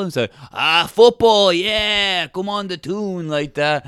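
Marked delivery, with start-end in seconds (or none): 0.5-3.3 high, drawn-out rallying shout; mock fan cheer. Locals hear the word football, high hammed-up cheering. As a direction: cheer like a delighted Newcastle supporter.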